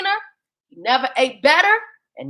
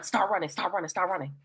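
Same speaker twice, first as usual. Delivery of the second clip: much softer, lower start running; whisper-close urging to flee; urging them on in a low hush; hushed urgency; 6 semitones lower, 12 dB quieter, faster than usual. Acted.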